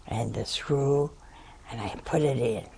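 plain reading voice

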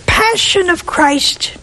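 slow, hushed plea to God; whispered prayer. Acted. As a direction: breathe the prayer slowly. Reciting the prayer.

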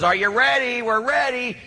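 high-pitched televangelist hype; Benny Hinn. Retold or imitated. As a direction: work up a stadium as a TV faith healer. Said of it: "He says".

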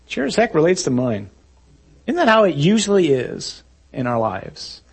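normal talking voice

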